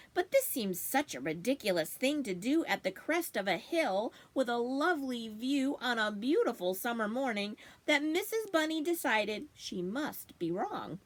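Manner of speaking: plain reading voice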